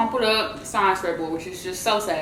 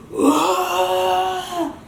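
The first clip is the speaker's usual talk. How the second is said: sharp gasp; 10 semitones higher than usual. Retold or imitated. Cousin goes crazy.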